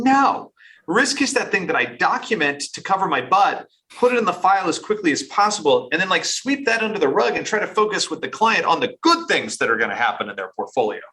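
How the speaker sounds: pitched high and rattled off fast; dismissive objection. Retold or imitated. Advisors rejecting a new idea about risk, dismissively.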